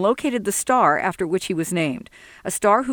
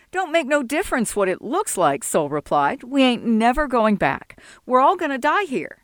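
put-on higher, defiant young voice; indignant young Sol; 5 semitones higher than usual. Sol rejecting Jesse's insult, a higher indignant voice. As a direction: bristle at being called a heathen. Sol's voice, hopeless.

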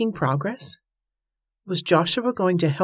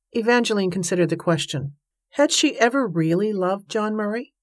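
ordinary delivery

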